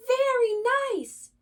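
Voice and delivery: loud high yell. A narrator's reading. Rabbit yelling to deaf Badger, loud and high. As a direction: shout it so deaf Badger hears. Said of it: "yelled Rabbit".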